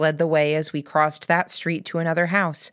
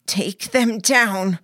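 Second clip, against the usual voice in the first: slow, louder and fiercer, leaning on each word; 5 semitones higher, 4 dB louder, much slower than usual. A narrator's reading. Narrator repeats the order to Mary, fiercer. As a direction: drive the order home, hard and deliberate. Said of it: "I said again, fiercer".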